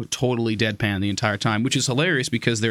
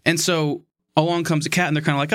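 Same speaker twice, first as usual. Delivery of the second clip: usual speaking voice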